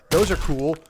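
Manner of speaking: ordinary talk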